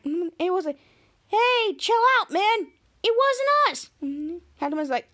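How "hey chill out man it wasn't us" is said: high-pitched defensive retort; protesting innocence. Retold or imitated. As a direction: push back, voice jumping up high. Kid protests innocence to Captain Man.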